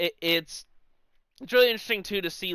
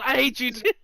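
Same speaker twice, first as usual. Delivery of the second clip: mock Batista comeback; 7 semitones higher than usual. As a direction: fire back at a young heckler, hammed up. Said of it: "And Batista goes".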